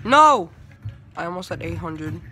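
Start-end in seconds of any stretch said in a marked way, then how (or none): 0.0-0.5 loud, higher cry of dismay; frustrated shout. Real, not acted. Player just short of eight hundred, frustrated shout. Game goes wrong for the player.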